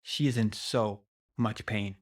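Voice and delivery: ordinary delivery, nothing marked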